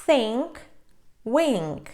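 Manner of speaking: "think" and "wink" with nasal NG closed by K; careful, separated model words, "think", "wink". Shown as a demonstration. Teacher giving examples of NK, nasal plus K. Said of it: "носовий звук н з призвуком к".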